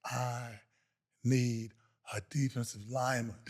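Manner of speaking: low, quiet, with long gaps; slow motion. Retold or imitated. Coach turns back to the benched player, slow-motion low delivery. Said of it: "it was like in slow motion".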